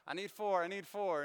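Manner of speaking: mock host shouting for four seats; calling out loudly. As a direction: call it out over the crowd like a busy guide. Tour guide calling for seats.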